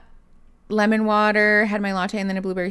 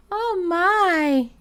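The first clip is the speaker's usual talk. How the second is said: surprised; 11 semitones higher than usual. Real, not acted. Reacting to cat's cries.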